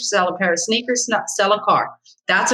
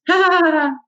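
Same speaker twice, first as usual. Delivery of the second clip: high nervous giggle; 10 semitones higher than usual. Retold or imitated. Nervous agent imitated laughing.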